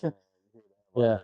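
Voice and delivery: normal talking voice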